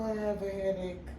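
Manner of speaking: normal talking voice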